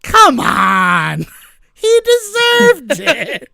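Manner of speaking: high, slow plea broken by laughter; laughing mock lawyer plea. Lawyer pleading to the jury, mock-pleading through laughter. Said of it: "The kind of lawyer whose argument is".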